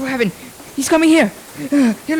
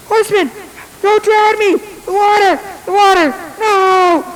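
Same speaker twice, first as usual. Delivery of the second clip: terrified pleading; 7 semitones higher than usual. Acted. Ichabod pleading with the horseman as water floods, panicked. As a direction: beg for your life as water rises.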